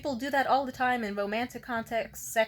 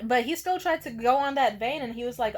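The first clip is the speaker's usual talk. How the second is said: ordinary talk, nothing marked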